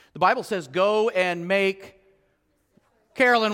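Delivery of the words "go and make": very slow, drawn-out Go and make; slow quote. Acted. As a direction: stretch the command out. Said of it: "slow quoted command".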